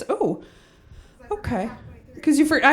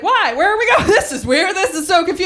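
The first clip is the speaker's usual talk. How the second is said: confused partygoer; 7 semitones higher than usual. Retold or imitated. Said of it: "And I was like".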